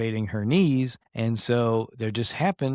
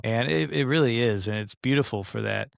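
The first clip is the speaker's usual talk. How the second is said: normal talking voice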